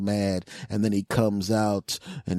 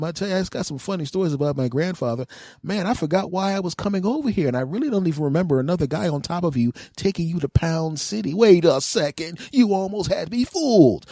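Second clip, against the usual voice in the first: high mock ditzy voice; 8 semitones higher, 3 dB louder than usual. Boyfriend voiced, mock forgetting.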